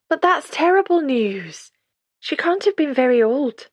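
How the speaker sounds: shocked sympathy. A narrator's reading. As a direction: take in the sad news.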